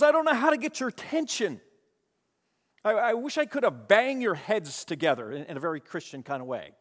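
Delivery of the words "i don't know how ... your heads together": high, loud pleading outburst. Acted. Paul pleading with wayward Galatians, exasperated and high. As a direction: plead like a frustrated apostle, pitched high. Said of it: "[name] could change his voice and his heart".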